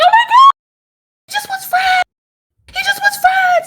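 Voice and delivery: very high-pitched squealed outburst; fangirl squealing. Retold or imitated. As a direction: squeal it like an overwhelmed fan. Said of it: "I'm, like, squealing".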